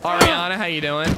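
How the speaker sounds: loud cheerful greeting; calling out. Real, not acted. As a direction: shout a hello across the room. Greeting a chat viewer loudly.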